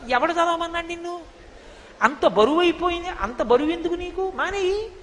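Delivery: lightly acted high-voiced dismissal; mock chiding. Acted retort to a grudging devotee.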